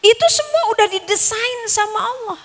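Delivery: usual speaking voice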